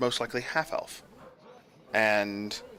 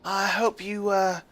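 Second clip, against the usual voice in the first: lightly voiced old senator; 5 semitones higher than usual. Old senator greets guards.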